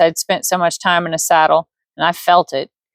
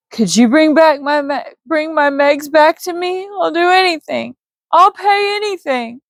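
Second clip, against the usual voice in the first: desperate pleading; 9 semitones higher than usual. Acted. Widower begs to revive wife.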